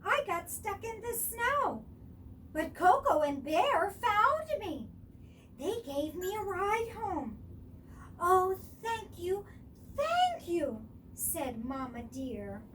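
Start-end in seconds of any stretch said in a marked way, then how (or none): 0.0-7.4 high, loud little fawn voice; Baby Deer. A narrator's reading. 8.2-10.8 high, louder heartfelt thanks; grateful mother. A narrator's reading. Mama Deer grateful.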